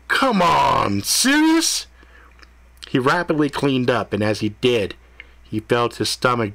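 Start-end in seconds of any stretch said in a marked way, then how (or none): 0.1-1.9 whiny disbelief, cracking high. A narrator's reading. Ethan groaning to the cat, pitch shooting high in disbelief. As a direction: voice jumping way up on serious.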